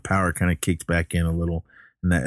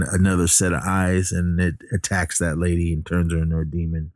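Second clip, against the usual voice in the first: usual speaking voice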